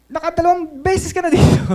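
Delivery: high, loud mock yell of an angry neighbour; imitated yelling. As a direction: shout it like the man scolding from afar. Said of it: "sinisigawan pa ako". (ginagayang sigaw ng kaaway)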